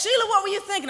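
mock exasperated mother. Mother questioning her child after a jump, mock exasperated. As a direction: imitate a mother taking a child to task.

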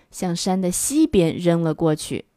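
usual speaking voice

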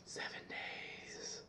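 slow eerie whisper; creepy child. Retold or imitated. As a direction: whisper it like a ghost girl. Repeating the creepy girl line.

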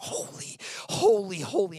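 "holy" cried out high and loud; crying out. Acted. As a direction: cry out holy like the angels. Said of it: "begin to cry out".

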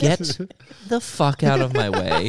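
loud laughing shout; laughing mock yell. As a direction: yell it while cracking up. Mock angry yell at pedestrian.

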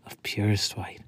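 breathy whispered phrase. Acted. Poet whispering a line of his poem to listeners, hushed. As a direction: drop to a whisper for the image.

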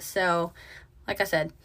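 ordinary delivery

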